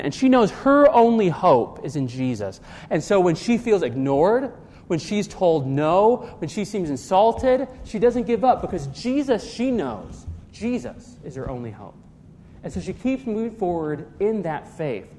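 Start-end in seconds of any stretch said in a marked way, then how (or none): none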